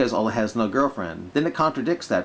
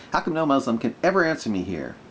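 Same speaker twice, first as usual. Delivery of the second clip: normal talking voice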